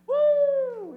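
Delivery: very high, loud whoop; gleeful whoop. Retold or imitated. Two thousand pigs whooping as they dive, very high and loud. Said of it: "two thousand pigs went".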